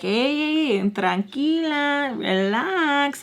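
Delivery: high, drawn-out "calm down"; imitated amused soothing. Caller laughing off her anger, high drawn-out soothing. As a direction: croon it slowly and high, stretching each word.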